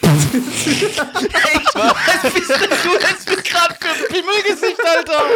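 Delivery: high, laughing through the words; can barely talk for laughing. Real, not acted. Laughing fit at friend.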